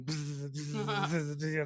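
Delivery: soft wasp buzz; imitated buzzing. Describing wasps he hears by his cigars, quiet buzzing noise. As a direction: buzz quietly like circling insects. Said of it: "like, buzzing".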